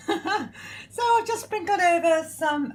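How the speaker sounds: high, slow, laughing through the words; real laughing. Real, not acted. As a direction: talk on through a laugh, pitch lifted. Laughing at her own baking habit.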